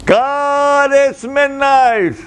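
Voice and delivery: high, loud, drawn-out cry to God; loud late-night prayer. Retold or imitated. Pastor crying out to God at midnight, loud and high. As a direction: call out to God slowly and loudly. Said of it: "she would hear me say".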